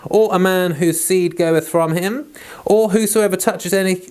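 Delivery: measured King James Bible reading; steady reading of Leviticus aloud; scripture reading. Acted. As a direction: read it out like a lesson in church. Reads Leviticus chapter aloud.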